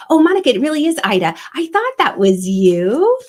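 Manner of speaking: thrilled to see a familiar viewer; excited greeting. Real, not acted. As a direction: greet her with a burst of joy. Spotting a viewer in chat.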